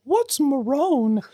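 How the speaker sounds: high, drawn-out mock mom; exaggerated high mother impression; put-on mom voice. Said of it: "trying so hard to keep up that voice".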